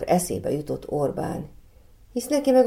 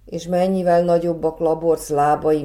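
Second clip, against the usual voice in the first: ordinary delivery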